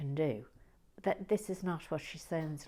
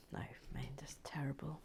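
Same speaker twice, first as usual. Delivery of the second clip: ordinary talk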